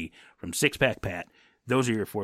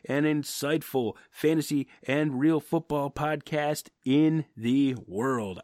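higher, louder announcer sign-off; podcast outro; 5 semitones higher, 4 dB louder than usual. Acted. Host signing off to listeners in a promo voice. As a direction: sell the show like a radio host.

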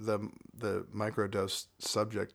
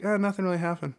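lightly voiced shrugging friend, higher; unimpressed shrug; 9 semitones higher, 6 dB louder, faster than usual. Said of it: "he was like".

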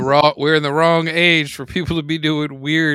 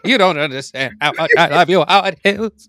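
lightly voiced transatlantic accent; a hint of old Hollywood transatlantic. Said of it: "Am I British?".

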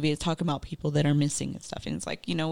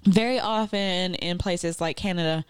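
ordinary talk

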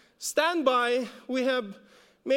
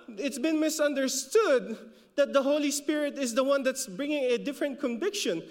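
ordinary delivery

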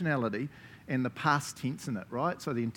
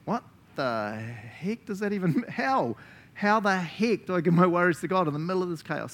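exasperated confusion; 5 semitones higher, 4 dB louder than usual. Pretend. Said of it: "the person's like".

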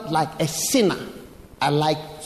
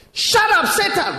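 sudden high yelled rebuke; shouted rebuke; 13 semitones higher, 4 dB louder than usual. Retold or imitated. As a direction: bark it at the devil. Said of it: "then suddenly he shouted".

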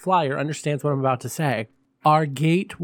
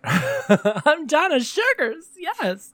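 higher, drawn-out diva hostess voice; campy drag hostess; 13 semitones higher, much slower than usual. Acted. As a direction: greet the darlings like a glamorous hostess. Persona introduces herself.

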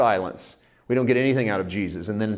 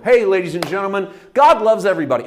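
loud, high mock pulpit announcement; mock church announcer; 7 semitones higher, 6 dB louder, faster than usual. Mimicking the church calling out to everyone. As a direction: ham up a preacher's big welcome.

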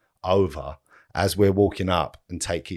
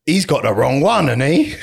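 quoting him; 6 semitones higher, 5 dB louder than usual. Retold or imitated. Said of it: "he literally went".

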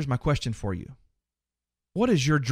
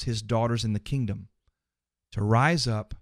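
ordinary talk, nothing marked

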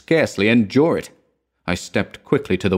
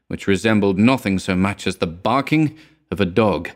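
usual speaking voice

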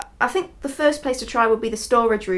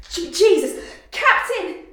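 high, loud frightened cries; alarmed; 8 semitones higher, 4 dB louder, slower than usual. Acted. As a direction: yell out in alarm. Discovering thousands of spiders.

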